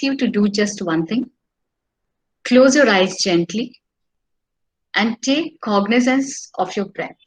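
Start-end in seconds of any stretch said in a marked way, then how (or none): 2.4-7.2 guided meditation. Acted. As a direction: lead the breathing calmly.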